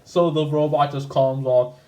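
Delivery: ordinary talk, nothing marked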